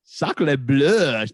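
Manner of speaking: put-on a quick, loud French-accent sacre bleu; jokey French accent; mock French. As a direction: toss it off in a hammed-up French accent. Mocking French stereotypes.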